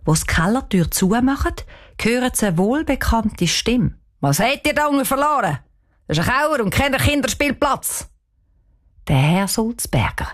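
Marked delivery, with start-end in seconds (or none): 4.2-8.1 lightly acted scolding, raised pitch; scolding caretaker. A narrator's reading. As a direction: tell the children off, pitched up. Caretaker scolds girls in the cellar. (echli gschumpfe, wien en Huswart)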